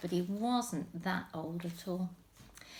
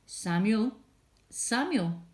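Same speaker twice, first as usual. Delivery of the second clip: louder, distant calling of a name; 4 dB louder, slower than usual. Acted. God's voice calls at night.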